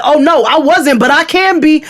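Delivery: high, loud defiant retort; retold threatening comeback. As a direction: re-enact daring the stranger on. Acted threatening comeback to stranger.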